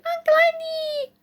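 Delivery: very high, thin drawn-out squeal; child's excited screech. A narrator's reading. Small boy running to the door to his uncle, high screech. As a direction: squeal it right at the top of your voice. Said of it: "It's greeted".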